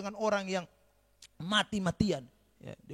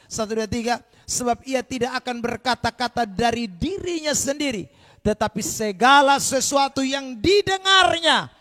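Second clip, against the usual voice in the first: loud, ringing scripture reading; booming pulpit recitation; preaching at full force; 2 semitones higher, 11 dB louder than usual. Acted. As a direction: proclaim the verse to the whole hall.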